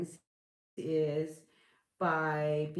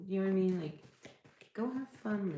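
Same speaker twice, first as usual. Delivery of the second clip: normal talking voice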